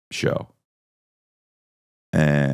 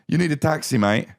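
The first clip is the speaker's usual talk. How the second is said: high-pitched London cabbie imitation; British accent; 8 semitones higher than usual. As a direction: mimic a London taxi tout. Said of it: "to hear their accents".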